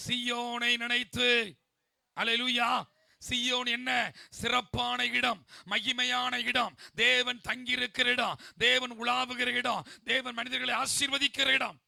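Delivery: loud, high, drawn-out proclamation; exultant preacher's cry, phrase by phrase; full-force preaching. Acted. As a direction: ring out each phrase slowly to the congregation.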